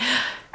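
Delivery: sharp acted intake of breath; shocked gasp. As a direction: gasp as if startled by it. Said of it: "that I was like".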